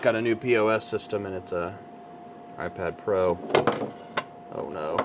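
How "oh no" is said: normal talking voice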